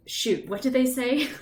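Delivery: high, loud mock panic; flustered player catching up on the chat; panicked thought. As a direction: blurt it like you missed everything. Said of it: "I'm like".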